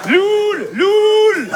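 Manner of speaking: put-on very high, drawn-out Moroccan-accented chat slang; high sustained cry, Moroccan accent; Hicham. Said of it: "l'accent arabe".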